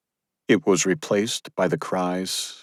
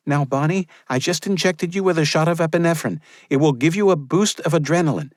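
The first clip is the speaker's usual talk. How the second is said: higher midwife voice, steady and guiding; calm firm midwife; 12 semitones higher than usual. Acted. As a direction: talk her through it calmly. Maria voiced by narrator.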